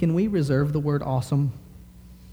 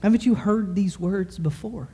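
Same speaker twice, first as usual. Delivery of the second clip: put-on child's voice, higher; 6 semitones higher than usual. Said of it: "She said".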